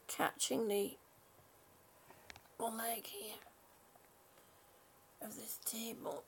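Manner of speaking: soft, hushed morning voice; hushed talk. Real, not acted. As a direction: keep it down so nobody wakes. Quietly talking while others sleep.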